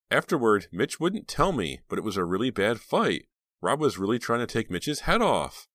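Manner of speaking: a hint of an eager teen girl; teenage girl voice. Reva voiced in the story.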